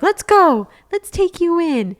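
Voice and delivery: high, loud eager invitation; pumped up, dragging her into the store; excited quote. Retold or imitated. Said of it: "And you were like".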